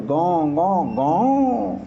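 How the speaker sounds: slow, stretched engine roar; engine revving. Shown as a demonstration. Teacher imitating a car being revved. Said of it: "accelerator दबाते हो".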